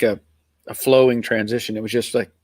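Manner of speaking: ordinary delivery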